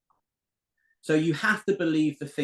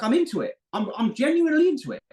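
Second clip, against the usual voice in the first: excited; 9 semitones higher, 5 dB louder, faster than usual. Real, not acted. Insisting he loves his studies.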